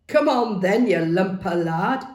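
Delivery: shouted dare, rising; loud taunt. A narrator's reading. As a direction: call out a challenge. Taunt heard from afar.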